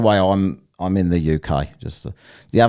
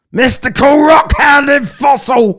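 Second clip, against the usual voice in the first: high, full-force drawn-out shout; slow, over-the-top yell; bellowed announcement; 10 dB louder than usual. Acted. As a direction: bellow the name like a hype announcer. Mock announcer bellowing out a name.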